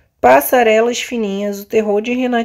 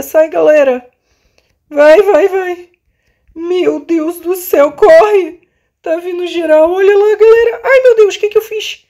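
high frantic calls to run; yell, hurry, they are close; being chased; 5 semitones higher, 4 dB louder than usual. Real, not acted. Everyone chasing her in game.

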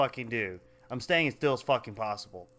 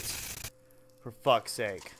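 muttered curse; 4 semitones lower, 5 dB quieter, much slower than usual. Real, not acted. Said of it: "For the sake of fuck".

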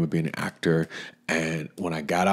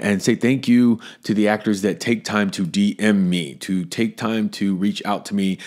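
ordinary talk, nothing marked

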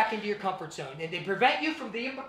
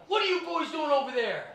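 retold yell; 8 semitones higher than usual. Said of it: "across the street yelled".

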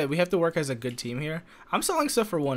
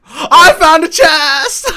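high, drawn-out yell of glee; triumphant shout; 14 semitones higher, 17 dB louder, much slower than usual. Real, not acted. Player crows to rival over loot, triumphant shout. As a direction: holler it out slowly, bursting with triumph. Crowing about a chest find.

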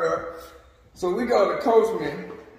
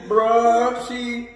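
ordinary talk, nothing marked

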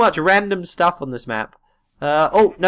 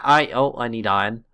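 ordinary talk

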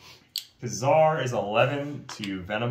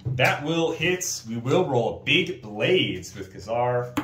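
normal talking voice

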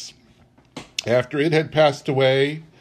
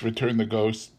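usual speaking voice

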